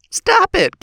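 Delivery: a sharp, very high "Stop it"; high pleading cry. Acted. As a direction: cut through the row with a yelp. A boy begs the family to stop.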